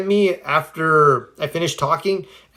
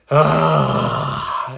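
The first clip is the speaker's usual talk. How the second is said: laughter breath. Shown as a demonstration. Coach shows a laughter breath to a viewer, airy half-laugh out-breath. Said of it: "almost a laughter breath".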